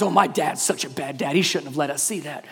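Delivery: low, soft, lightly voiced sulky kid; a hint of a sulking boy; a soft, low mock grumble from a sulking son; mock-whining son. Mocking his son's complaint.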